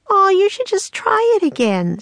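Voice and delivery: very high, pleading protest; insistent girl. A narrator's reading. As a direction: push him in a high, whiny-kid plea. Annie argues with Jack.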